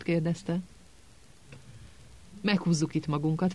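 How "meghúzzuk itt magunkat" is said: ordinary delivery, nothing marked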